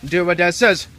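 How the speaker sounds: usual speaking voice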